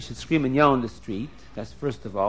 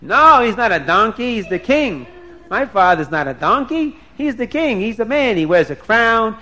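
higher-pitched, defensive protest; indignant denial; 5 semitones higher, 4 dB louder than usual. Acted. Prince defends his father to Dina, indignant denial. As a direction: set her straight, offended. Prince objects to donkey insult.

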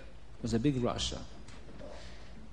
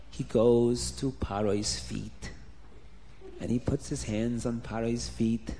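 plain reading voice

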